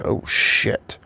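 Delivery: soft breathy whisper; whispered oh shit. Retold or imitated. To himself as pancakes arrive, whispered. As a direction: whisper it in quiet surprise. Surprised by pancakes at Waffle House.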